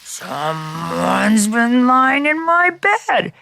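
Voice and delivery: very slow, drawn-out bear voice; big bear, slow and heavy; great big Papa Bear. A narrator's reading. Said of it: "in his great big voice".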